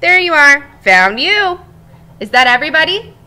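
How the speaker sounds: lively read-aloud voice for the class; storybook narration. Acted. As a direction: tell it like a cheerful egg to children.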